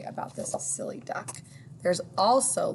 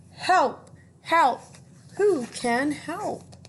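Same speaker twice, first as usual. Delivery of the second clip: duck crying for help; 9 semitones higher, 5 dB louder, much slower than usual. Acted. Stuck duck calls for help.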